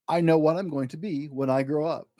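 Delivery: plain reading voice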